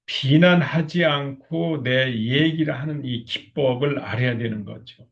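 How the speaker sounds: ordinary talk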